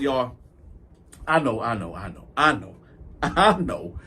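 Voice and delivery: ordinary talk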